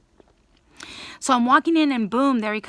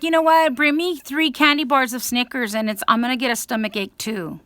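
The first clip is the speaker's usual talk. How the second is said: loud, high, defiant demand; defiant shout; 6 semitones higher, 9 dB louder than usual. Retold or imitated. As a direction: throw the challenge back at them loudly. Defiant retort to bookstore staff.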